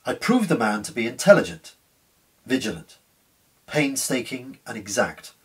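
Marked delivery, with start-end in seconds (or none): none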